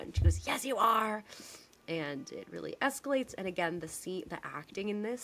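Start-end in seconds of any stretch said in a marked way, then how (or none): 0.5-1.2 lightly heated retort, higher and louder; mock arguing. Host acts out wife contradicting husband, mock heated. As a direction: imitate an insistent comeback. Acted wife in escalating argument.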